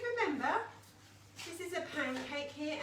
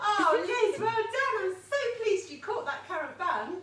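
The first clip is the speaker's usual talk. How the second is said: excited praise; 8 semitones higher, 10 dB louder, slower than usual. Real, not acted. Praising child who caught bun.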